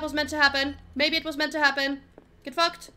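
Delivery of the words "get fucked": normal talking voice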